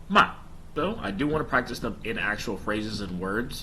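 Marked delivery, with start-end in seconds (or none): 0.1-0.3 ma dropping sharply from high; falling tone. Shown as a demonstration. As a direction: snap ma down, fourth tone. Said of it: "And then the falling tone".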